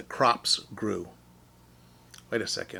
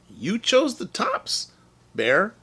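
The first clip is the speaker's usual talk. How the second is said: sly innocent Hare; 8 semitones higher, slower than usual. Acted.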